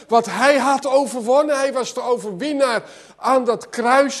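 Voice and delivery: loud, high, insistent preaching, pressing each question; preaching at full force. Acted. Preacher rousing the congregation about the cross, full-force preaching. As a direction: hammer the victory home to the congregation.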